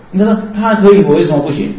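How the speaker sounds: indignant complaint. Acted. Pupil objecting that another is allowed, indignant complaint. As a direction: protest like a pupil claiming unfair treatment.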